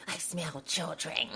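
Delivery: slow, unvoiced witch whisper. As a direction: hiss it slowly like a witch. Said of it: "She's like".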